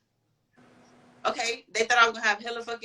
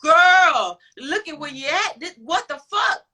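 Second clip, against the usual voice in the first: higher, louder disbelieving yell at herself; retold shout at herself; 6 semitones higher, 7 dB louder, slower than usual. Telling a friend how she yelled at herself, a loud disbelieving shout. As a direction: snap at yourself to wake up.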